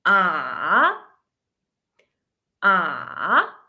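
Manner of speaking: long drawn AH that dips and swings up; third tone, dipping. Shown as a demonstration. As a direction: sink down on AH, then sweep upward. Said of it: "fallen and then rising".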